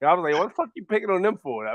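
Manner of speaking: usual speaking voice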